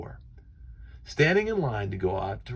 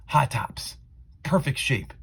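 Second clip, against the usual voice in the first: usual speaking voice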